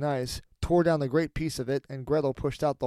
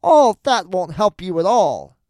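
loud, slow, raised witch voice; scolding old witch; 4 semitones higher, 10 dB louder, slower than usual. A narrator's reading. Witch dismisses Gretel's prayer, loud slow scorn. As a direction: drive each word home loudly. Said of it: "said the old woman".

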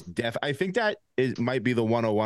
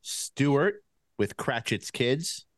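ordinary talk

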